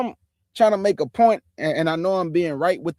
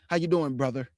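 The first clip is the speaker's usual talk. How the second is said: low, softer put-on brother greeting; mocked coworker acting down; 5 semitones lower, 5 dB quieter than usual. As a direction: imitate a coworker trying to sound cool, low.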